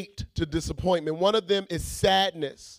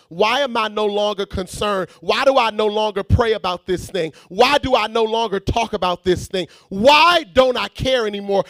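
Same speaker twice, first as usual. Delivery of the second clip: louder, rising preacher's questions; building sermon cadence; preaching build; 3 semitones higher, 6 dB louder than usual. Real, not acted. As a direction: press the church with each why.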